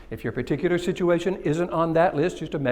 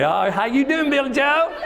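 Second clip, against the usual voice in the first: folksy Southern hello, pitched up; Southern accent; 7 semitones higher than usual. Acted. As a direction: greet them in a hammed-up Southern twang. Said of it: "that's a great Southern name".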